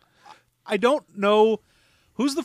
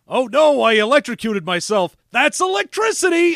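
high, loud goofy punchline, much higher; dumb joke delivery; 5 semitones higher, 5 dB louder than usual. Retold or imitated. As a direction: squeal the lame joke proudly. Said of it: "he's like".

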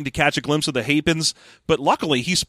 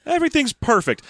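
high-pitched mock bliss; super happy; 9 semitones higher than usual. Leia reassuring Han, play-acted super happy. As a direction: gush lightly, as if all is wonderful. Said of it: "she looks super happy".

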